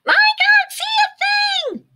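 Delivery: very high, loud panicked shout; yell. A narrator's reading. Pip blind in the night sky, high panicked yell. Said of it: "yelled Pip".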